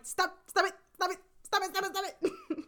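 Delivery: high, repeated squeaky pleading; mock flustered protest. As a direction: imitate someone fending off too much fussing. Retold protest at being over-pampered.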